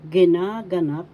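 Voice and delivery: ginaganap said loudly with a long NA; stress on second syllable. Shown as a demonstration. Teacher modelling for learners, loud ginaganap stressed on NA. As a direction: punch and stretch NA in ginaganap. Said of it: "The second".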